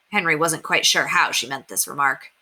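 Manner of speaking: plain reading voice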